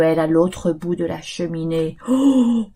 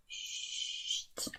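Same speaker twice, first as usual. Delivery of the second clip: shushing whisper; 26 dB quieter than usual. Acted. Shushing demo.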